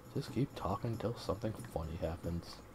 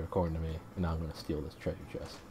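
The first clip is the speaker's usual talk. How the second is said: ordinary talk, nothing marked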